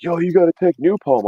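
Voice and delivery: high, pushy friend voice; mock the friends high and pleading. Friends insisting he drive the drunk buddy home, high.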